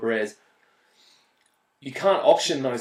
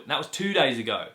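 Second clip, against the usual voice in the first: ordinary talk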